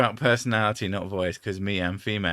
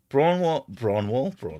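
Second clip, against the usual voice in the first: Cornish Braunwall with a rolled broad vowel, groping for it; trying a Cornish accent; 3 semitones higher than usual. Shown as a demonstration. Trying to find the Cornish accent, Braunwall in Cornish. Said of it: "I'm trying to".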